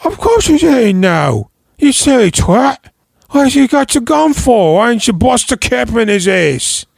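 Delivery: high, loud angry Cockney rant; impression of a furious gangster yelling at Tommy; Statham. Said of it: "It's my Statham".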